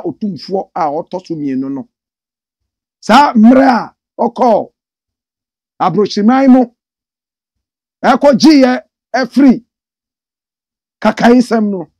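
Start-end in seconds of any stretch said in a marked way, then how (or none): 3.0-11.9 loud, slow, high rallying proclamation; stirring proclaim. Acted. Praising the king to live viewers, loud slow proclamation. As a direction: ring it out like a praise singer.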